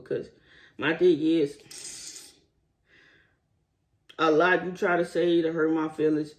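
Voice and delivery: ordinary talk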